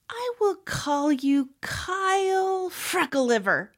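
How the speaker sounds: put-on high, slow, declaring child voice; high-pitched make-believe pronouncement; playful little girl. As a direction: announce a silly name with relish. Girl invents silly name for Papa.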